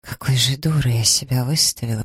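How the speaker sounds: ordinary delivery, nothing marked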